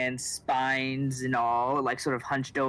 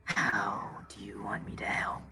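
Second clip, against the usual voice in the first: whispering creature; 3 semitones lower, 5 dB quieter than usual. Acted. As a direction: whisper it like a cave creature, softly. Creature answers the player.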